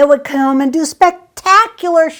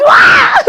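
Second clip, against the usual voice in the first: mock anguished shout; 11 dB louder than usual. Mock hurt reaction.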